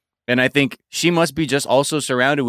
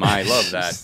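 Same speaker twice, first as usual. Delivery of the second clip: ordinary talk, nothing marked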